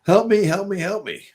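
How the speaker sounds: high, loud begging help me; mock desperate plea, high-pitched; mock pleading. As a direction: whine it like a needy client. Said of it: "and they go".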